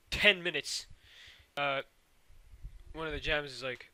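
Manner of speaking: usual speaking voice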